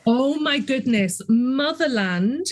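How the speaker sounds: normal talking voice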